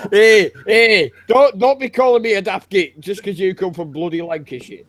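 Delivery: banter in thick Yorkshire; broad Yorkshire accent. Shown as a demonstration. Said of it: "bloody Yorkshire".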